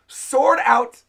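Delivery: ordinary talk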